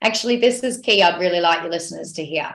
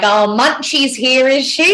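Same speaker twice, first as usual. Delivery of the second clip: higher, slower, playful mock-surprised tease; teasing husband; 4 semitones higher, 4 dB louder than usual. Husband teasing his grumpy wife, playful higher sing-song. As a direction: sing it out like you're gently ribbing a grumpy wife. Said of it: "he's like".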